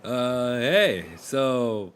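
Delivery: sailor voice. Acted. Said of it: "they go".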